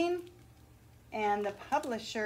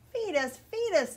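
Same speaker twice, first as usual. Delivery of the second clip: put-on shrieking baby birds; 9 semitones higher than usual. A narrator's reading. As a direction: shriek like starving nestlings. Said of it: "the little ones shriek".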